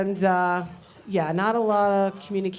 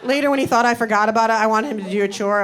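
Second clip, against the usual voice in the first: ordinary talk, nothing marked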